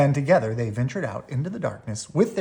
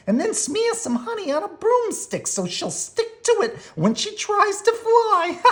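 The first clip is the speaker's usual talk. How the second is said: Too Tall bully voice; 4 dB louder than usual. A narrator's reading. Too Tall telling the gang his plan, high gloating voice.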